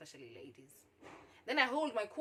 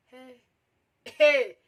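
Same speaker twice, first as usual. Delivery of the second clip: shocked exclamation; 3 semitones higher, 18 dB louder than usual. Retold or imitated. Storyteller reacting to his indecent suggestion, shocked.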